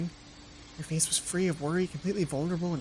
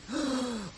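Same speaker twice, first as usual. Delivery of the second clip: gasp; 6 semitones higher, 4 dB quieter than usual. Real, not acted. Reacting to override warning.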